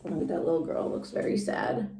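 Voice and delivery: ordinary delivery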